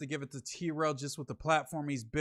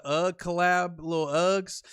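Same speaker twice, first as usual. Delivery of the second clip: ordinary delivery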